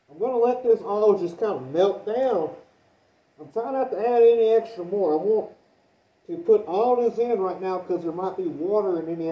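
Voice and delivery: muffled behind a respirator mask; talking through a half mask respirator. Real, not acted. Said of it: "through the respirator here".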